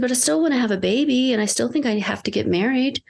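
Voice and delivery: put-on teen boy. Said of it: "He said".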